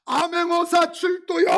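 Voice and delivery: slow, high ringing shout of the royal inspector's herald; herald's shout. Acted. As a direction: cry it out like a court herald. Preacher acts herald's call. (암행어사 출두를 외치는 전령의 우렁찬 외침)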